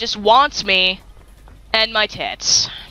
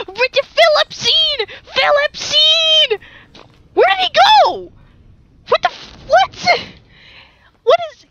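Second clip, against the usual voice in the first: gasp, then very high squealing; high, frantic searching cry; high-pitched alarm. Real, not acted. Loses sight of the rat.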